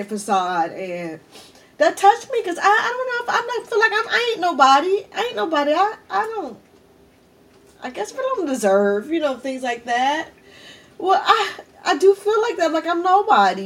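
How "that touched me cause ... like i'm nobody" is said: real high-pitched, tearful voice; choked up. Real, not acted. Telling viewers she feels like nobody, choked up. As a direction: voice climbs high as tears come, halting and restarting. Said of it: "Got your girl crying again".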